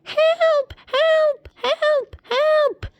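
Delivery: very high, squeaky cartoon cries for help; tiny panicked voices calling for help; screaming meatballs. Meatballs rolling out of the crashed bus, very high screaming. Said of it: "and they were screaming".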